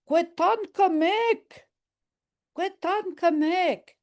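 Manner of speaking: high, slow delighted cheer; joyful praise for a kid; overjoyed praise. Shown as a demonstration. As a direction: beam with pride at a child. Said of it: "you're overjoyed at something".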